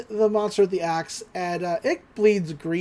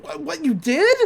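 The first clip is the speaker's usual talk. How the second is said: higher, stammered mock surprise; startled disbelief; 8 semitones higher than usual. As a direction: act out a flustered boy caught off guard. Dipper learns Wendy knew.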